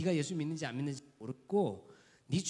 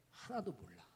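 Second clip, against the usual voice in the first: usual speaking voice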